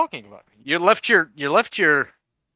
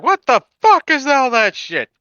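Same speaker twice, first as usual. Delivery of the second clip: high, loud angry innkeeper; angry tavern owner; 9 semitones higher, 5 dB louder than usual. Retold or imitated. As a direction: bark like a furious landlord. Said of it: "gonna fucking just come in and be like".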